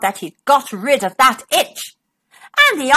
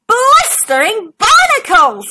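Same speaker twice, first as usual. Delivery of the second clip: squeaked; 7 semitones higher, 3 dB louder than usual. Acted. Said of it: "she squeaked".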